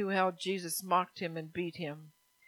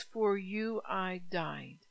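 slow weighty quote; much slower than usual. Retold or imitated. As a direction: let "For you I died" land word by word. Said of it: "He says".

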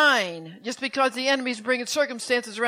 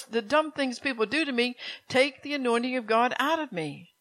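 ordinary talk, nothing marked

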